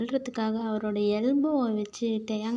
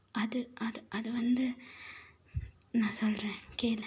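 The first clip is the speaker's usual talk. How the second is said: soft, halting start; reluctant confession, voice dropped; hesitant; 6 dB quieter than usual. Acted. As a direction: stall quietly before explaining. Caught out, hesitantly begins confessing.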